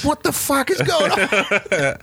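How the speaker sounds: high, slow bewildered cry; mock baffled outburst, drawn out; bad acting. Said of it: "And it's like".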